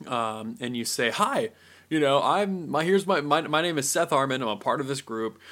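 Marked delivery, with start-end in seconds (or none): 1.1-5.4 upbeat, confident self-introduction; confident, personable. Acted. Pitching a group poster to a business, confident and personable. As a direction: introduce yourself boldly, voice lifted. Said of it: "you're just really personable".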